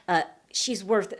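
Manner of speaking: ordinary talk, nothing marked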